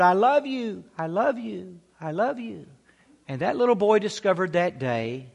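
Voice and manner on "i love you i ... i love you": imitated echo, growing softer each time; fading echo. Valley answers the boy's call, fading echo. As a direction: let each repeat die away like an echo. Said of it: "And then he heard".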